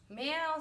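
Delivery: high meow; cat meow. A narrator's reading. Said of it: "said Cat".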